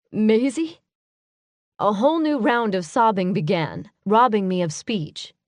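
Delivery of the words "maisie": cracking voice. A narrator's reading. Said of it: "His voice cracked".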